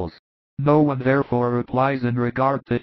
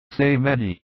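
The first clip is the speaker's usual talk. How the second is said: plain reading voice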